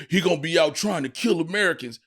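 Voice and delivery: usual speaking voice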